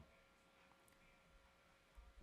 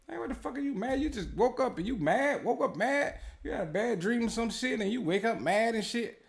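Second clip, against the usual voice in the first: pitched way up, mock disbelief; incredulous; 12 semitones higher than usual. Telling someone off for waking up angry, high incredulous. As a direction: needle someone for waking up mad. Addressing someone who woke up mad.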